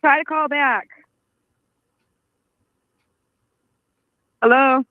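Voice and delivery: shouting into a phone. Real, not acted. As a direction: raise the voice to be heard on the phone. Calling out on dead line.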